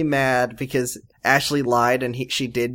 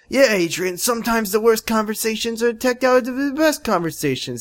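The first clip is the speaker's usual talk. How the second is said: high mock voice; 7 semitones higher than usual. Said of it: "he's like".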